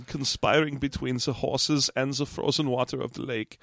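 put-on low, hushed German-accented narrator; mock-solemn documentary voice; Werner Herzog. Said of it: "Werner Herzog comes on and narrates".

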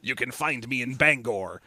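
a pirate murmuring a sly invitation; sly aside. Pretend. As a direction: murmur it like a secret wink. Camp pirate joke.

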